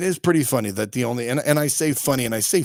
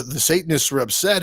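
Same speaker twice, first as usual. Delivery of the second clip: ordinary talk, nothing marked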